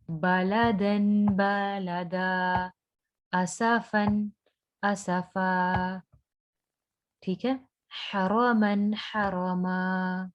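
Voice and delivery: each word stretched slowly, ending vowel held; slow teaching read with long endings; slow drawn-out recitation. Shown as a demonstration. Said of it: "खींचना भी है".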